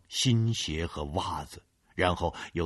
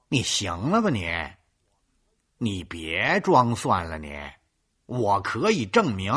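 sassy scolding; 3 semitones higher, 6 dB louder than usual. Acted. Girl backs him up, scolding.

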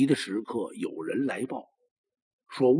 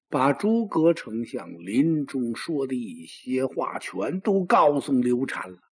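plain reading voice

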